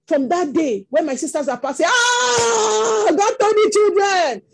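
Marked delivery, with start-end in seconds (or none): 1.9-4.4 long, high wailing cry of greeting; madman's frantic hailing. Retold or imitated. Madman sees the feared father's children passing, frantic hailing. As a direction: draw out a high mock cry, then tumble into the words.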